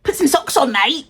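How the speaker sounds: lightly exasperated British telling-off; British accent coming out. Retold or imitated. To [name] about socks, mild British telling-off. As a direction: scold him for bare feet, British style. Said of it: "I get so angry when the accent come out".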